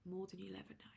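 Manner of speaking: soft, unvoiced whisper; hushed repeat, barely audible. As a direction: whisper it intimately to the listener. Whispered repeat of the same line.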